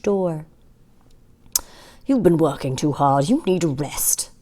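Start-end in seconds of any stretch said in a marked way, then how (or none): none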